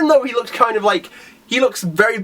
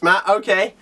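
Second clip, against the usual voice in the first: ordinary talk, nothing marked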